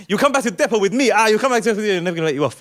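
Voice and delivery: high, loud mock rant; driver going nuts. Bus driver threatening passengers while spinning round, going nuts. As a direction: shout threats in a manic imitation. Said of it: "they're going nuts".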